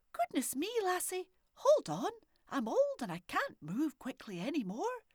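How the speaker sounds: lightly voiced old granny calling out. A narrator's reading. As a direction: call to the door, fussed.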